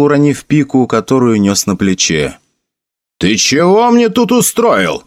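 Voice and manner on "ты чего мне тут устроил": high, drawn-out indignant demand; angry outcry. A narrator's reading. Cas confronts Felix over leaving his troop, crying out angrily. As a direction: demand answers in outrage. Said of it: "вскричал Кас".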